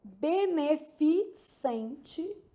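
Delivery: Beneficente very slowly, syllable by syllable; marking the syllables. Shown as a demonstration. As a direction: break it into be-ne-fi-CEN-te. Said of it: "marcando as sílabas tônicas aqui".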